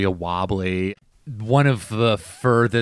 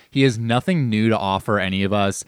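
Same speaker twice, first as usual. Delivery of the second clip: ordinary delivery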